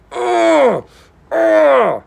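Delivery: high strained grunts of a crushing bear hug; hugging grunts. Retold or imitated. Grunting high while imagining a bear hug from Joe. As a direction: squeeze out two high grunts as if hugged hard.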